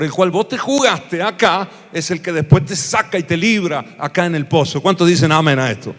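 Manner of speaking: high, loud, rousing pastor's sermon; full-force revival preaching; fiery preaching. Acted. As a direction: preach it to the back row.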